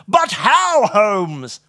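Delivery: high, loud burst of astonishment; irritatingly loud. A narrator's reading. Watson demands Holmes explain himself, irritatingly loud. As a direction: blurt it out far too loudly. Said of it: "possibly an irritatingly loud voice".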